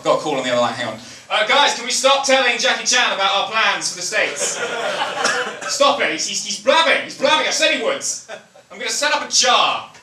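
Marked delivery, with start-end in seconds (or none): 1.3-9.9 America to the team. Retold or imitated. America calling cabinet on other line.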